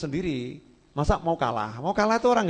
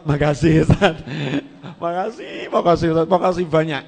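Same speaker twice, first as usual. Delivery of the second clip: thanks broken up by a laugh; sheepish mock thanks; 5 dB louder than usual. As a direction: laugh through the pain and say thanks. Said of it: "Jane ya loro, tapi".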